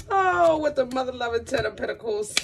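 bursting out high and loud at the card; thrilled squeal at good news; excited exclaim. Real, not acted. Reader thrilled by the card, high excited exclaim.

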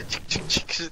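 whispered lighter clicking noise; lighter clicks. Retold or imitated. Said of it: "Zie je hem echt zo".

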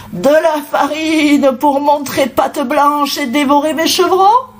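high, outraged mother goat; indignant scolding. A narrator's reading. Mother goat suspecting the wolf of a trick, indignant. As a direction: accuse him in rising indignation. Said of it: "gronda la maman chèvre".

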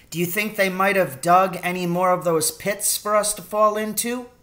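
worried son, voice lifted. A narrator's reading.

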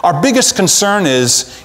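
ordinary delivery

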